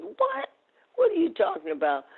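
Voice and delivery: high-pitched incredulous "what?"; retold disbelief. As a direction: act out being taken aback, voice jumping up. Retold disbelief at doctor's orders.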